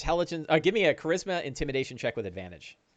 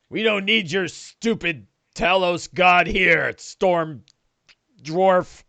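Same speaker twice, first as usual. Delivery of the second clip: higher, slow creature voice; scornful orc; 4 semitones higher, 4 dB louder, slower than usual. Acted. Orc insults the storm dwarf's god, scornful creature voice. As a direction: sneer as an orc at a foe. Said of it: "And he's like".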